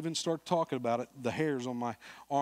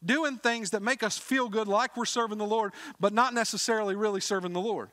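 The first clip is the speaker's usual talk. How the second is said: high, loud pulpit exhortation; fervent sermon call to act; full-force preaching; 9 semitones higher, 5 dB louder than usual. Acted. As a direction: urge the church to go out.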